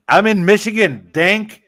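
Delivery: ordinary talk, nothing marked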